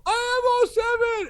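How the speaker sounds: hammed-up superfan yell; high dopey yell. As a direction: shout it like an overexcited simpleton. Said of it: "And I just kept yelling".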